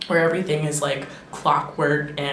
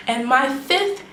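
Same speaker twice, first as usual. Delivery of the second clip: higher, slow drawn-out announcer build-up; build-up announcement; 4 semitones higher, 4 dB louder, much slower than usual. Shown as a demonstration. Vlogger teasing her last reason for viewers, slow announcer build-up. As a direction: stretch it out like a game-show host. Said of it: "drum roll please".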